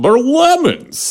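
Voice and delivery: high, slow, drawn-out correction; mock old man. Old man telling his wife about the fruit, high mock dismay. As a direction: swoop up high on a stretched comic discovery. Acts out old man's discovery.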